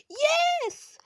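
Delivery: triumphant yes. Real, not acted. Player cheering a narrow success, triumphant. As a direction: cheer the win.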